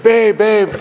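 imitating a frantic call for help; high, urgent calling out to babe; a retold panicked cry for his wife. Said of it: "like that".

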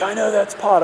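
mom's suspicious voice. Retold or imitated. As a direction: play the mom who smells the weed. Mom catches him smoking weed.

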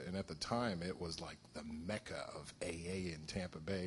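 usual speaking voice

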